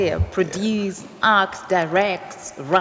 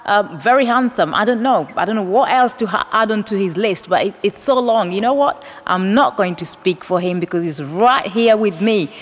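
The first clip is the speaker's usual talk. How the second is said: upbeat, projected show-opening voice; lively host introduction, louder than chat; TV presenter intro; 5 semitones higher, 8 dB louder than usual. Acted. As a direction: welcome viewers and build up the special guest. Presenter opens the show to camera.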